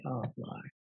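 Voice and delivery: hushed, worried Oh Lord; quiet worried aside. Retold or imitated. Daughter fearing her mom fell asleep, hushed. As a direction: murmur it under your breath. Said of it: "I'm like".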